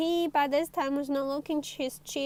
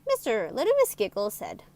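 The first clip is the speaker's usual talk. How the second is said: usual speaking voice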